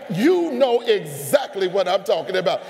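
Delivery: shouted at the room; loud call-out. Real, not acted. Preacher shouting at the congregation. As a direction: make them admit it loudly. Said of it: "repeated shouted".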